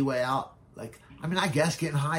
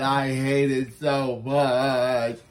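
imitating Casper; 6 dB louder, much slower than usual. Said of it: "he would just talk about".

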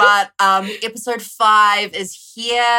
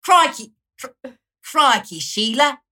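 mock Australian accent, higher; 7 semitones higher, 6 dB louder than usual. Host retrying Australian accent for friends, mock Australian. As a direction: ham up Aussie catchphrase. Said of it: "put on an Australian accent".

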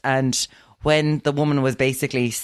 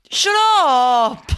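mock shout; 11 semitones higher, 5 dB louder than usual. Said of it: "he was like".

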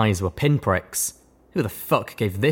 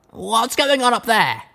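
Blackjack voice; 14 semitones higher, slower than usual. A narrator's reading. Blackjack questions the stallion.

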